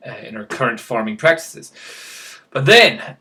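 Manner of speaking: usual speaking voice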